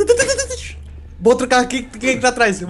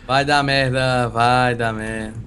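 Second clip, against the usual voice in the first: ominous low voice; 10 semitones lower, much slower than usual. Pretend. Ominous slow low drawl.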